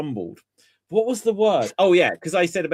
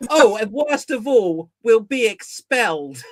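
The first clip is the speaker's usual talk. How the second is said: higher, louder, slowing English schoolgirl voice; Hermione Granger; 5 semitones higher, 5 dB louder than usual. Retold or imitated. Nervous friend reverting to a schoolgirl at the embassy, imitated high. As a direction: mimic a prim, alarmed English pupil. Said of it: "he suddenly just reverts to Hermione Granger".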